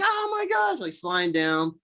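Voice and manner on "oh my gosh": retold squeal, pitched way up; mock scream. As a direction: act out screaming on the way down. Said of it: "she's, like, screaming the whole time".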